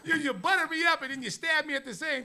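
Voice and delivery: high, loud wounded complaint; hammed-up indignant shouting; mock outraged protest. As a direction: yell it as comic betrayal, half laughing. Retelling his hurt comeback to coach.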